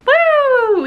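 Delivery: very high, loud sarcastic whoop; mock cheer. Real, not acted. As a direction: cheer with fake enthusiasm.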